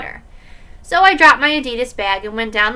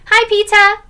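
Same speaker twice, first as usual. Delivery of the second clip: lightly voiced little kid, higher and louder; higher, louder kid-brother hello; 11 semitones higher, 7 dB louder than usual. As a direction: chirp a greeting like a small boy. Little brother greets the narrator.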